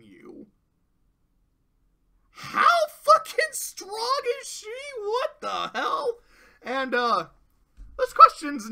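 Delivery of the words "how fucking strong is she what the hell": much higher, louder, slow, near a wail; cracked, crying exasperation; shrieking disbelief. Real, not acted. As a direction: wail it like you can't believe it. Exasperated outburst about her power.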